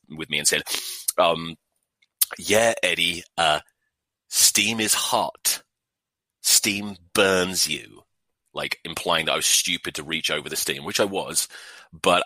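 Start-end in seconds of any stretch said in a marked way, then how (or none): 2.4-8.0 slow, mock-patient sarcasm; sarcastic. Girlfriend mocking his steam burn, slow sarcastic drawl. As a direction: drag it out like explaining to a child. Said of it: "she got all sarcastic with me".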